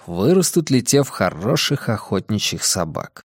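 ordinary delivery

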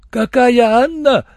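high, loud, slow cry; shouting. A narrator's reading. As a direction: scream the question in alarm. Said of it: "закричал седой негр". (громко, высоким криком)

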